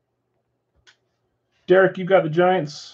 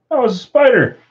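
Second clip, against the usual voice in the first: high, loud startled protest; freaked out, pitch jumping up then falling; alarmed; 6 semitones higher, 5 dB louder than usual. Real, not acted. Host reacting to a fallen spider, alarmed outburst. Just had a spider fall on them.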